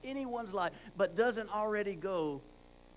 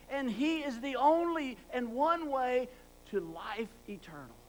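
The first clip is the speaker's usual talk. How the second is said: preaching at full force; 5 semitones higher, 6 dB louder than usual. Acted. Preacher explains the Greek meaning to the congregation, forceful. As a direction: pound out each "one and only".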